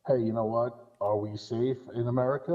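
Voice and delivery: normal talking voice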